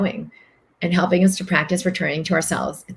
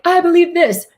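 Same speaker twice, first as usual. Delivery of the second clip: much higher, louder mock declaration; mock stubborn certainty; 11 semitones higher, 5 dB louder than usual. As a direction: ham up a know-it-all insisting. Mocking a stubborn mind.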